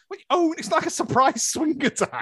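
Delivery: high, mock-startled exclamation; mock surprise. Viewer reacting to the swing scene in mock shock. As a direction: act startled at the sudden swing. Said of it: "I was like".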